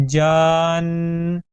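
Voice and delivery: جان with the jeem stretched long, gliding into nun. Shown as a demonstration. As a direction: hold جان very long and join it. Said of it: "بلکہ".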